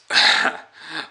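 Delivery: high, loud mocking vampire laugh; villain laugh.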